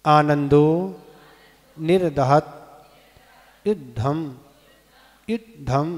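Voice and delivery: each word said separately, measured and deliberate; pronunciation demo. Shown as a demonstration. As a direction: sound out the Sanskrit for pupils to repeat. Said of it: "एक बार और". (एक-एक शब्द का स्पष्ट उच्चारण)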